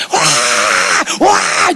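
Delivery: mock shrieking cry, long and drawn out; imitated screaming wail. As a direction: wail "waaah" like a mother yelling. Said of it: "seperti mamanya kalau udah teriak begitu".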